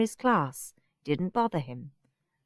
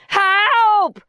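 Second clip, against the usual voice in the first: high loud cry for help; shouted; 14 semitones higher, 9 dB louder than usual. A narrator's reading. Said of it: "shouted Horrid Henry".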